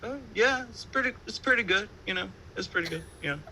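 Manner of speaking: high-pitched, unconvincing politeness; mock polite, noncommittal approval; feigned approval. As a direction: cover your real opinion with squeaky praise. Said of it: "I don't wanna say that. I was just like".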